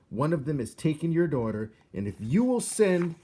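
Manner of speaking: loud, high urgent plea; urgent mouse. A narrator's reading. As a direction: push the plea before being cut off. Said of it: "mouse interrupted mid plea".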